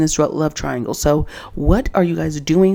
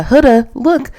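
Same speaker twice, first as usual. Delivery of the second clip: imitating Shelly; 7 semitones higher, 5 dB louder, slower than usual. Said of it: "and she says".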